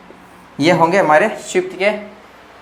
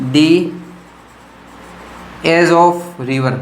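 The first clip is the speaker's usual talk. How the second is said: slow dictation; much slower than usual. Shown as a demonstration. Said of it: "teacher dictates the English meaning".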